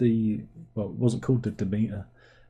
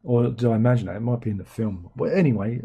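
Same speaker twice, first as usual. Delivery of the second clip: ordinary talk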